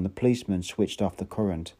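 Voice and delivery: ordinary delivery, nothing marked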